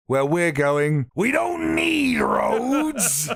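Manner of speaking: dramatic slow build to a shout; movie quote voice. Pretend.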